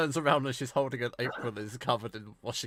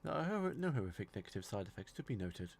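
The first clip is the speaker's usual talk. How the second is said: computer voice; 2 semitones lower, 15 dB quieter than usual. Acted. AI computer answering.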